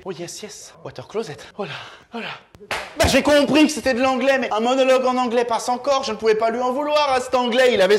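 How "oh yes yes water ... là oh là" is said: put-on murmured English accent. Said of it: "je l'entends murmurer".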